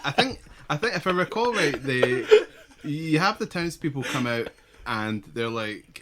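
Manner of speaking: ordinary talk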